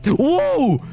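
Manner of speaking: high alarmed yelp of surprise; jolted by the jump scare; startled high cry. Real, not acted. Gamer sees the monster again, startled high cry.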